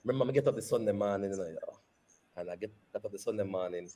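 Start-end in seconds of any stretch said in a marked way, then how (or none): none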